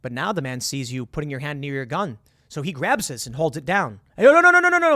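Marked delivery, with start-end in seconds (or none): 4.2-4.9 high, loud rapid-fire panicked no's; acted yell, pitched way up. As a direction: imitate an alarmed man yelling. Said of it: "and he yells".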